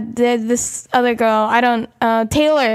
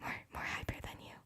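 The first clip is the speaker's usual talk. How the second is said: imitating a friend's secretive whisper; 14 dB quieter, faster than usual. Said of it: "Karen whispered next to me".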